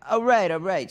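lightly acted comic babysitter; a hint of a scene character.

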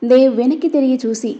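ordinary delivery, nothing marked

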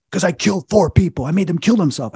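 high, loud confession; retold outburst. Demon confessing to the exorcist, retold outburst.

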